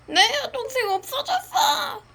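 high, tearful wail; crying. Acted. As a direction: cry out the loss. Sister has been kidnapped.

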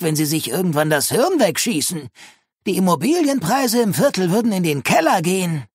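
high-pitched, sneering neighbour; mock him in a high, lecturing voice; scornful. A narrator's reading. Neighbour lecturing single Linus about being alone, scornful. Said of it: "Misses Clapper schnaubte höhnisch".

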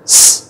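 "s" as a long hiss; voiceless S. Shown as a demonstration. Teacher contrasting two sounds, voiceless S. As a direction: hiss "s" without any voice. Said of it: "âm S và âm giờ".

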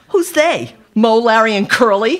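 high, loud sarcastic sneer. A narrator's reading. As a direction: sneer the question scornfully. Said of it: "Christina sneered".

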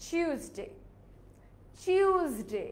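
টিউসডে opening with a CH sound, "chyus-day"; original pronunciation. Shown as a demonstration. Teacher revealing the original pronunciation, CH-sound Tuesday. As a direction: soften the T of টিউসডে into CH. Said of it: "original উচ্চারণটা কি হবে জানো?".

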